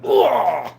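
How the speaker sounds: mock disgusted ugh. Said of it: "and then we're like".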